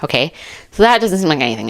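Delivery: normal talking voice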